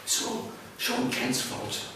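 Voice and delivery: hushed, fearful grumbling; muttering crowd. Acted. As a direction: mutter it under the breath like a frightened crowd. And they were saying.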